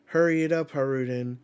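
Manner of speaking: loud, high, hurry them along; impatient shout. Acted. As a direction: bark the order across the field. Warriors urging Haruden.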